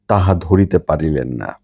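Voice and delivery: usual speaking voice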